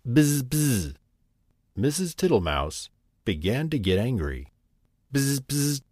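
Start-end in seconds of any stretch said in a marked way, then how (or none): 0.1-0.9 a mouse mimicking the bee's buzz; mimicked buzz. Mouse mocking the bee to her face, a mimicked buzz. 5.1-5.8 a peevish little buzzing squeak. A narrator's reading. Bee answering the mouse, a peevish squeak. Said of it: "replied Babbity Bumble in a peevish squeak".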